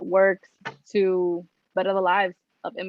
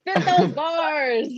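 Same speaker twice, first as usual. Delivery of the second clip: mock hype; 7 semitones higher, 3 dB louder, much slower than usual. Real, not acted. Joking hype at friend's speech.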